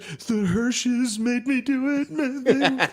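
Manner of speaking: put-on high, drawn-out kid wail; mock tearful whine. Drunk son blaming the Hershes to mom, mock tearful whine. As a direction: plead the excuse through laughter.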